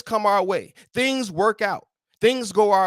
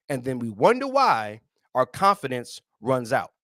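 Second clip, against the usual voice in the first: ordinary talk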